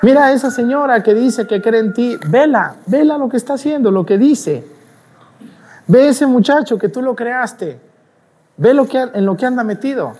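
high-pitched gloating devil tattling to God; taunting devil. Acted. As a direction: jeer like an accuser pointing out sinners. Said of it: "Para que el diablo ... voltear a Dios y decirle".

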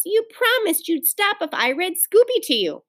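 high-pitched exasperated complaint; crying out in protest. A narrator's reading. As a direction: protest a broken promise. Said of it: "cried Beezus".

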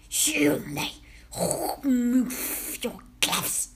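slow, rasping guttural command; harsh foreign-accented witch voice; Grand High Witch. A narrator's reading. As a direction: roll the R harshly, stretch each word. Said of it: "she shouted".

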